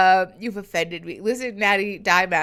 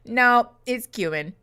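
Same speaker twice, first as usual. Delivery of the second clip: normal talking voice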